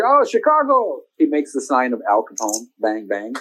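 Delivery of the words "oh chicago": much higher, delighted Oh, Chicago; a soldier lighting up at Chicago; high mock excitement at Chicago.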